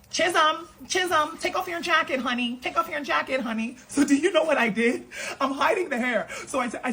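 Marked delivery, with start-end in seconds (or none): 0.1-3.8 high, sing-song teacher calling a name. Retold or imitated. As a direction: call the kid sweetly from across the room. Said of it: "my teacher's like".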